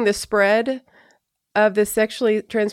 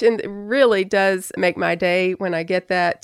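normal talking voice

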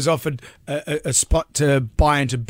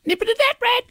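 very high little talking-radiator voice; Nat Rad. Acted. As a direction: squeak it out as a tiny cartoon radiator. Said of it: "it's just a high voice".

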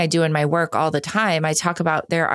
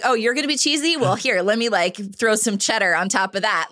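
higher, teasing mock voice; playful spouse one-upping the cheesiness, higher and teasing; playful cheesy banter; 4 semitones higher than usual. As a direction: ham up the over-the-top romantic. Voicing their cheesy banter.

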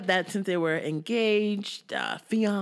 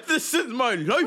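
mock angry quote, laughing. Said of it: "he was so mad".

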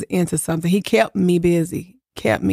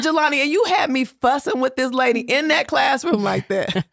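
higher, laughing mock scolding; laughing reproach; 7 semitones higher than usual. Real, not acted. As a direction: tease him through laughter, pitched higher. Laughing reproach to her son.

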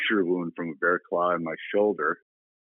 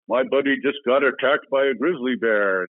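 louder, higher imitation of a big man; boisterous retold announcement; 6 semitones higher, 5 dB louder than usual. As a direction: announce it to the whole bar. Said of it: "boisterous voice".

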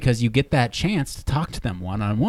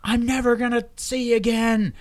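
hammed-up screaming, pitched way up; retold panicked scream; 11 semitones higher than usual. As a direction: imitate a man wailing in panic. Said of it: "like, yelling and screaming".

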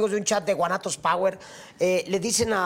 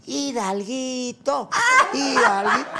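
drawn-out playful call; 3 semitones higher, much slower than usual. Acted. Drawn-out joking name, shouted.

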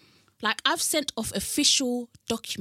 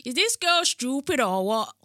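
higher, louder imitation of a Bajan granddad; granddad's Bajan accent; 4 semitones higher, 5 dB louder than usual. Granddaughter quotes her granddad, in his Bajan accent. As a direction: echo granddad's Bajan exclamation. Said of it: "That's what my, my granddad said".

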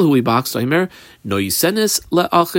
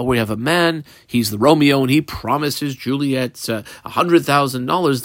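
ordinary talk, nothing marked

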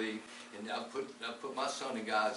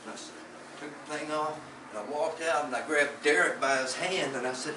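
ordinary talk, nothing marked

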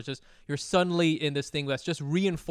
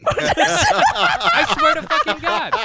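high squealing laugh through the words; can barely get it out for laughing; laughing hard; 10 dB louder, much slower than usual. Real, not acted. Cracking up at the oranges joke, laughing loud and high.

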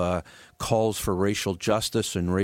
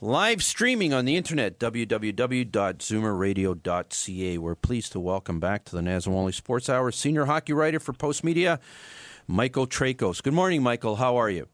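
bright on-air welcome; radio host; 3 semitones higher than usual. Acted. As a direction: greet the listeners like a host. Host opening the show.

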